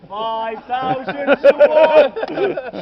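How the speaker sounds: booming slow proclamation. Acted. Guide to tour group, booming the odds out slowly. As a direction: bellow it out slowly, each word stretched. Repeats odds, much louder, drawn out.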